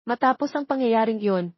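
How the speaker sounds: ordinary delivery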